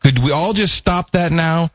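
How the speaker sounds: hammed-up British take on Southern accent. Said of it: "is that your idea of a Southern accent?".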